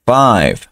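"Five" with the I of the letter name, no final E; five, silent E. Shown as a demonstration. Teacher models "Five" with a silent E. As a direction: say "Five" without the E. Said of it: "Esta E no se pronuncia".

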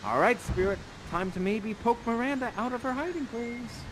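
normal talking voice

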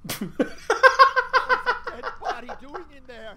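loud, slow laughing yell; laughing shout. Real, not acted. Friend yells at the body.

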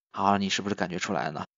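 ordinary talk

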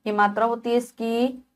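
ordinary talk, nothing marked

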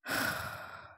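short, airy exhale; real sigh, all breath; breathy sigh. Real, not acted. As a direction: let out a quick sigh. Fed up with the game.

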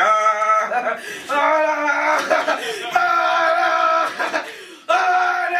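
high, mounting mock wails; dithering cries. Lady stuck mid-street crossing, high dithering cries. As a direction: wail in rising, indecisive bursts. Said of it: "She was like".

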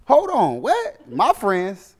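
high-pitched disbelief; incredulous. Acted. Employee questioning his manager's accusation, high incredulous protest. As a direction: squeal it back in indignant disbelief. Acted indignant reply to manager.

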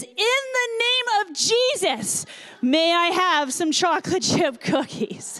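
high, slow, drawn-out mock yell; imitated child's yell. Three-year-old's last plea in the store, imitated high yell. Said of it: "yells as loud as his little three-year-old voice can go".

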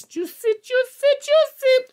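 very high squeaky chant; giddy squeal. Real, not acted. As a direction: squeal it in glee. Gloats over the rare card.

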